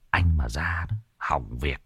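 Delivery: breathless warning under the breath; whisper. A narrator's reading. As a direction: whisper so nothing hears. Warning in the dark. (thì thầm cảnh báo)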